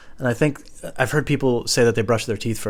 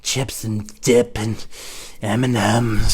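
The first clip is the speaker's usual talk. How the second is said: slow dazed drawl; slower than usual. Retold or imitated. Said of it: "I'm just like".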